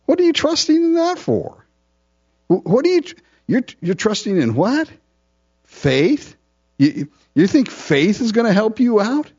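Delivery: preacher voicing the enemy mocking the believer's faith, high and sneering; mocking enemy taunt. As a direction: taunt them like a scornful accuser. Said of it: "the enemy often asks us in this kind of tone".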